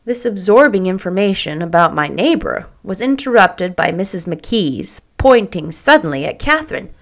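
usual speaking voice

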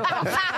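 short, high laugh; laughter. Real, not acted. Contestant reacts to the host's joke, laughing. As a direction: burst briefly into a high laugh.

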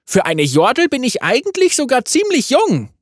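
high, cheeky yordle voice; playful little gunner; Tristana voice. A narrator's reading.

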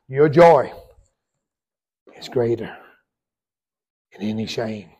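shouted climax of the sermon; preaching shout. Real, not acted. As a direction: belt it to the whole church. Sermon point, repeated as climax.

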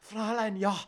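loud, high mock German; hammed-up German caricature; horrible German accent. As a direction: shout it in a badly faked German accent. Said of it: "my German accent at this time is horrible".

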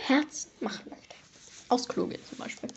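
breathy secret whisper. Pretend. Secret wish of the Sim.

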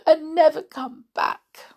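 a mild sob in the voice; tearful. Acted. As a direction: doll weeping to the toys. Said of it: "wept Amelia".

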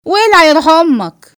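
loud, high, drawn-out comeback; angry retort. Acted. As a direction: throw it back as a slow, loud insult. Insulted woman snaps back in quarrel. (رد غاضب وممطوط بصوت عالي)

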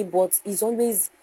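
ordinary delivery

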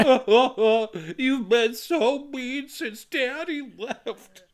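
put-on high, slow whimpering little boy; a hurt kid sulking at his mother; whiny child. The son complaining to his mom, whiny and slow.

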